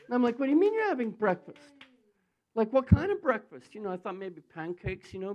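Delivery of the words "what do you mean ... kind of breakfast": loud, high incredulous outburst; astonished demand for an explanation; retold disbelief. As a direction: act flabbergasted, louder and higher. Retold disbelief grows on hearing breakfast.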